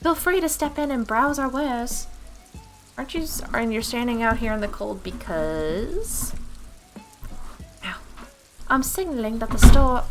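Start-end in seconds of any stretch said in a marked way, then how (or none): 0.0-2.1 put-on higher, inviting girl's voice; chirpy child shopkeeper's pitch; eager little shopgirl. Girl inviting customer into shop. 8.7-10.0 put-on loud, higher girl's voice; insistent young shopgirl; eager little shopgirl. Girl explaining, loud and eager.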